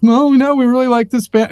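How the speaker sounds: high, eager mock-earnest voice; nice band in interview. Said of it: "the bands were really nice".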